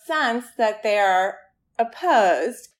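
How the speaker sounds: usual speaking voice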